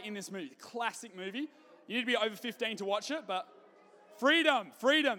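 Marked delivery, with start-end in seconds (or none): 4.2-5.2 Scottish battle cry, loud and high; bad Scottish accent. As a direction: yell it like a Braveheart Scot in a bad Scottish accent. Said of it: "that's the worst accent ever".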